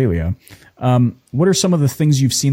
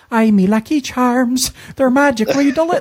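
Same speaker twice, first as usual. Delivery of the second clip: much higher, hammed-up Irish lilt; mock Irish accent; 13 semitones higher than usual. Canadian host mimicking what Australians heard, hammed-up Irish accent. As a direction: parody a Lucky Charms leprechaun brogue. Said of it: "all they heard was".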